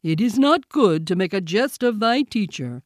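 reproving character voice; Baloo the bear, chiding. A narrator's reading. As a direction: chide as an offended teacher. Bear chides the boy.

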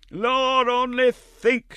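very high, slow, fawning greeting; effusive Mrs Mann. A narrator's reading. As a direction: gush at him in a shrill sing-song.

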